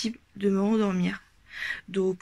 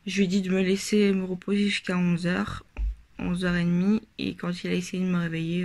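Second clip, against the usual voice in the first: ordinary talk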